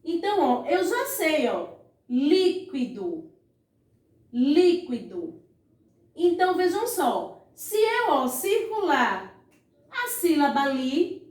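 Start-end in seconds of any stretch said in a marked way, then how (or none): 2.1-5.4 "líquido" said slowly, falling low; drawn-out word. Shown as a demonstration. Teacher showing pupils the accented word, said slowly. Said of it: "eu vou chamar a palavra líquido".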